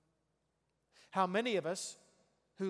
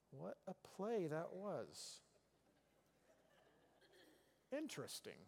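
slow, much softer flat reaction; deadpan unimpressed; 13 dB quieter, slower than usual. Pretend. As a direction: say it like you couldn't care less. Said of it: "I'm not gonna respond on the couch with a".

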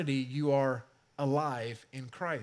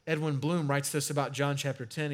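ordinary talk, nothing marked

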